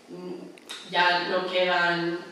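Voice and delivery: ordinary delivery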